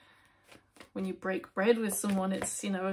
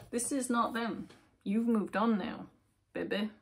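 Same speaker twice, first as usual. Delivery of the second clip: ordinary talk, nothing marked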